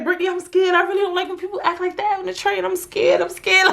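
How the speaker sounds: high, hurried imitation of a frightened friend; mock panic, pitched well up; Laura scared. Friend pleading with Brittany about the train, imitated scared. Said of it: "she was mad scared".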